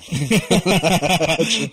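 high, slow, loud mock voice; mocking silly voice. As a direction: parody the phrase in a silly high voice. Mocking his own phrase.

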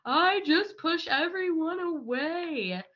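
a mild imitation of a crying girl, high and slow; tearful confession. Girl crying to her trainer in the arena, lightly imitated tearful voice. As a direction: let it come out slow and a little tearful, not fully sobbing. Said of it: "she just starts crying".

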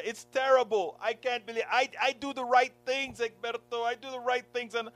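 high, pleading imitation of a tearful friend. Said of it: "she's boohooing and crying".

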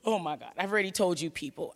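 ordinary talk, nothing marked

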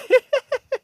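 squealing laugh; high burst of laughter. Real, not acted. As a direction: crack up at the joke. Laughing at Vince's joke.

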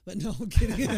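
laughing it off. Real, not acted. Joke about hating dad.

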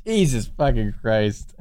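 ordinary talk

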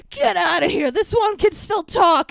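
hammed-up villain shouting; loud mock villain yell; coachman barking. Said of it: "And he's like".

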